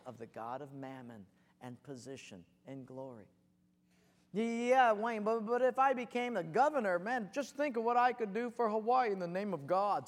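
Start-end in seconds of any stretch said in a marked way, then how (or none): 4.3-10.1 loud, higher, excited objection; eager protest. Acted. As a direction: plead your big ambition with gusto. Acted ambitious objection, eager.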